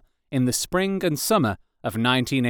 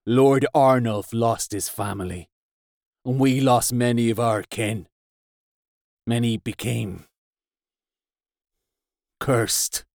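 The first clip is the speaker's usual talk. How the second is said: grieving warrior. Acted. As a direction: admit the loss with regret. Hafgan tells of the loss.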